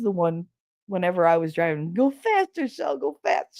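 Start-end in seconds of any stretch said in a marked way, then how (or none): 1.9-3.6 high, egging-on voice; eager brother impression. Brother urging sister to drive faster, high and eager. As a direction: egg her on like a thrill-seeking passenger.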